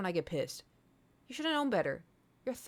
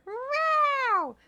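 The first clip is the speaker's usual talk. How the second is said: high, loud, long drawn-out cat meows; Thor meowing; 12 semitones higher, 5 dB louder, much slower than usual. Retold or imitated. Imitating her cat Thor yowling from the bedroom, high and loud. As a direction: yowl it out like a cat calling from the bedroom. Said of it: "and I hear".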